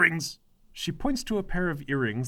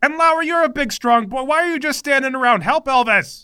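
higher, demanding mother voice; mother barking orders at the team; bossy mother; 10 semitones higher, 8 dB louder than usual. Acted. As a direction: order everyone around like an imperious mom.

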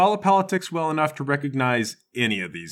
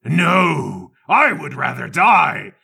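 loud, high spiteful declaration; hammy old politician gloating; defiant villain; 6 semitones higher, 6 dB louder than usual. Acted. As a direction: spit it out with relish. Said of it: "The other congressman says".

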